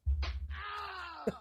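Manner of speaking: high shrieking burst of laughter. Real, not acted.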